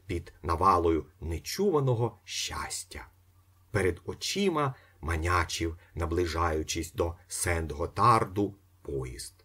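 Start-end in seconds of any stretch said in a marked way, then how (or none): none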